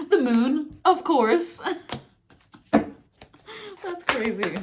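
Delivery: high, loud laughing disbelief; amazed laughter. Real, not acted. Reader seeing the moon card turn up, laughing in disbelief. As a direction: crack up at the uncanny coincidence.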